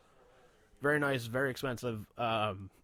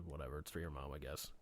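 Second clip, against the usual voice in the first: 1 reluctant mumble; 9 semitones lower, 13 dB quieter than usual. Retold or imitated.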